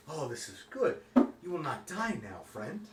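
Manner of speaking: a hint of a relieved NPC; lightly voiced baker. Baker NPC speaks in character.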